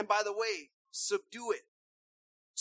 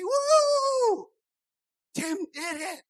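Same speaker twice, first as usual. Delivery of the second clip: much higher, loud whoop of joy; whooping cheer; 9 semitones higher, 7 dB louder, much slower than usual. Acted. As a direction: celebrate like your kid just won. Parent celebrating child's success.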